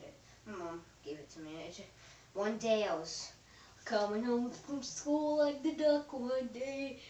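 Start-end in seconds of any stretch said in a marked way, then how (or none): 3.8-7.0 silly voice. Pretend. Silly made-up story voice.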